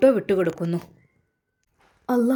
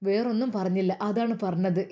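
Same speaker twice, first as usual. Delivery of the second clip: ordinary delivery, nothing marked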